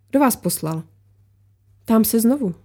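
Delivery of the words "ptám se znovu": usual speaking voice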